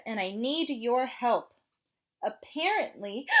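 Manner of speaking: hiccupy penguin asking for help; gasping little penguin; penguin character voice. Acted. Penguin asks kids to scare.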